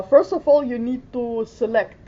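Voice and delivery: normal talking voice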